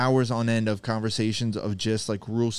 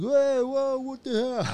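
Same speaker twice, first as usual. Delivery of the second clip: much higher, startled yelp of a tickled fighter; mock shocked. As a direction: jump way up in pitch, caught off guard. Opponent tickled mid-match.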